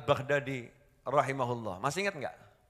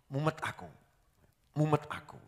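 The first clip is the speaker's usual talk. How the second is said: eager complaint; 4 dB quieter than usual. Retold or imitated. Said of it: "dengan wajah yang bersemangat itu".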